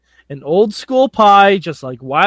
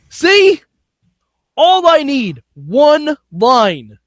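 exasperated; 8 semitones higher, 4 dB louder, much slower than usual. Real, not acted. Exasperated rant.

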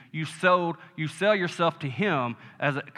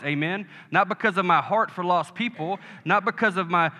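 rising, louder preacher declarations; sermon build-up, higher and louder; preaching at full force; 4 semitones higher, 3 dB louder than usual. Acted. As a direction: proclaim each line like a battle cry.

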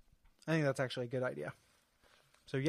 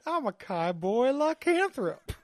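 slow, high cowboy drawl; 7 semitones higher, 4 dB louder, slower than usual. Acted. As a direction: drawl like a werewolf cowpoke. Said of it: "it's still Cowboy Week".

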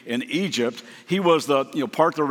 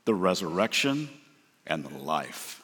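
low, much softer reverent close; hushed solemn words; 7 semitones lower, 6 dB quieter than usual. Acted. Preacher closing Jesus' declaration to the congregation, a low hush. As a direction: let it fade to a hush. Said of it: "he drops this one".

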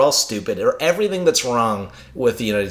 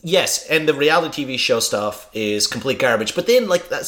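usual speaking voice

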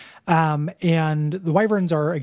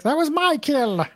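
put-on high childish whine; possessive whining; 12 semitones higher, slower than usual. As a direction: whine like a spoiled brat. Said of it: "shows up and is like".

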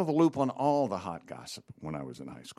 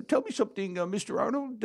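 high, put-on teacher voice; odd-talking coach; 11 semitones higher than usual. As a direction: impersonate the peculiar coach, pitched well up. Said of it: "Can you do his voice?".

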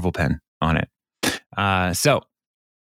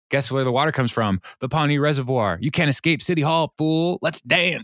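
higher, building playful challenge; mock showdown taunt; 6 semitones higher than usual. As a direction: taunt like a hammed-up pursuer who has cornered someone. Acted chase showdown in the fountain.

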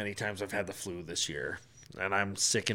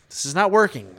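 louder, higher frustrated insistence; retold growing irritation; 7 semitones higher, 7 dB louder than usual. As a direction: push the complaint harder, louder and higher. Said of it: "I'm getting a little pissed off".

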